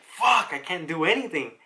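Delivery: mock desperate outburst. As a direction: retell a helpless, desperate cry. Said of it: "full of desperation".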